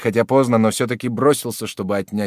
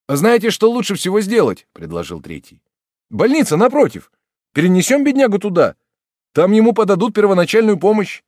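eager bystander; 9 semitones higher, 6 dB louder than usual. Acted. Third bystander proposing hospital.

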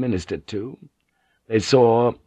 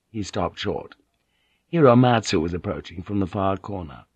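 usual speaking voice

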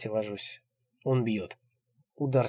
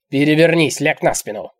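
very loud, harsh command; impatient order; 4 semitones higher, 13 dB louder than usual. A narrator's reading. Old man orders her over, impatient. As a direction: shout it out of lost patience.

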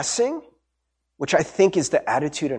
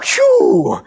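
relieved phew; 6 dB louder than usual. Retold or imitated. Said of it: "Someone was like".

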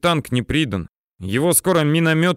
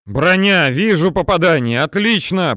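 raised, high-pitched report; excited radio call; 5 semitones higher, 4 dB louder than usual. A narrator's reading. Officer praising the tank crew's hit, loud high radio call.

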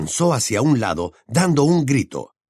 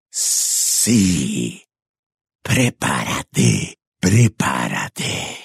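very low, slow, rough menace; sinister whispering voice; 10 semitones lower, much slower than usual. A narrator's reading. Unseen voice warns the pinned boy, low sinister murmur. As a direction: intone it slowly, deep and creepy. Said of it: "susurró la voz".

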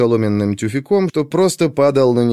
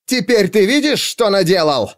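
high-pitched angry yell; 7 semitones higher than usual. Acted. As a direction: yell the accusation at him. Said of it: "снова заорал Алкивиад". (кричит со злостью)

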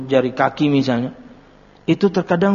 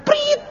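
whistle imitation.

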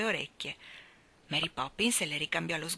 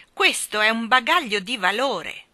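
loud, raised booming voice; thundering voice; 5 semitones higher, 8 dB louder than usual. A narrator's reading. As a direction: boom it out indignantly. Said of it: "Una voce tonante". (voce tonante e alta)

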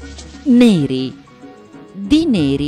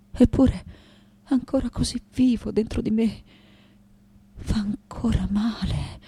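hushed grief; 4 dB quieter than usual. Acted. Daughter returning to a demolished childhood home, hushed grief. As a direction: let the breath carry the pain. Remembers the lost home, pained.

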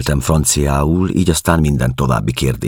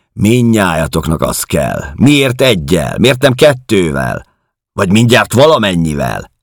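bitter mockery; 6 semitones higher, 6 dB louder than usual. A narrator's reading. Woman mocking a man who asks for women, bitterly sarcastic. Woman sneers at his request.

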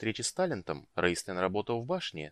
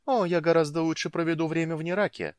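high, louder amused reply; amused, lively dismissal; laughing reply; 9 semitones higher, 6 dB louder than usual. A narrator's reading. Woman says she prefers staying in Neraka, amused reply. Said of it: "рассмеялась Иоланта".

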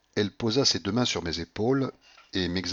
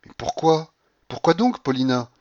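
high, louder, insistent questioning; urgent, pitched-up disbelief; dismayed protest; 8 semitones higher, 6 dB louder than usual. A narrator's reading. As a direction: plead for a reason. Narrator protests her refusal.

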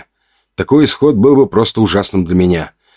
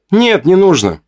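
quick high-pitched comeback; unrestrained retort; 10 semitones higher than usual. A narrator's reading. Narrator unable to hold back his answer, retorting. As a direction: blurt the refusal back, pitched up. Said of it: "не сдержался я от ответа".

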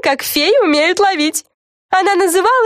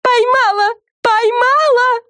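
very high, triumphant cry; shouting; slower than usual. A narrator's reading. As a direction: cry out in triumph, drawing out the repeat. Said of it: "закричала Френ". (ликующий тонкий крик)

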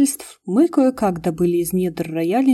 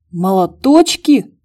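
slow, louder incredulous question; can't believe what he heard; indignant disbelief; 8 dB louder, slower than usual. Acted. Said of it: "возмутился братец". (возмущённое недоумение)

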